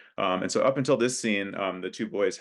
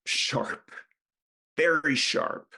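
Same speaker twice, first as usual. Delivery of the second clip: put-on drunk; 7 semitones higher, much slower than usual.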